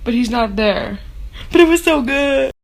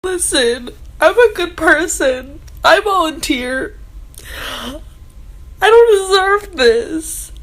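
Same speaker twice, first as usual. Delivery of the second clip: much higher, teary laughing sobs; laugh-crying; 5 semitones higher, slower than usual. Real, not acted. Reader laugh-sobbing over the book's ending. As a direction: cry and laugh over the twist.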